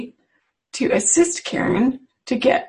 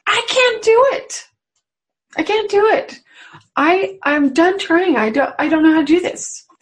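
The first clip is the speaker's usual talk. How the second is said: put-on high, pleading little-child voice, much higher; helpless little child; 6 semitones higher than usual. Praying to God as a helpless little child, high pleading. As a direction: plead like a tiny child who has given up. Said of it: "willing to say".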